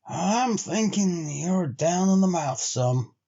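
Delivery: lightly voiced frontiersman, slow and dry; a hint of a grizzled sled driver; Henry, a weathered trail man. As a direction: give Henry a plain-spoken backwoods drawl. Henry's lines in the story.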